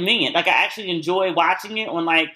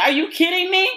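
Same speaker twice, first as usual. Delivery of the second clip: mock outraged disbelief; 10 semitones higher than usual. Miranda finds the condom wrapper.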